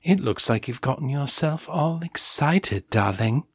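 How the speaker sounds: posh, playful imitation; Morgan's posh accent. A narrator's reading. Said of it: "still imitating Morgan's accent and vocal patterns".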